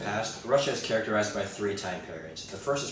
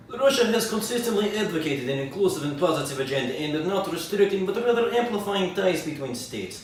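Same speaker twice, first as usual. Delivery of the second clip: put-on pompous Russian accent, higher; Russian official accent; 7 semitones higher, 6 dB louder than usual. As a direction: read it like a Kremlin spokesman. Official Russian statement parody.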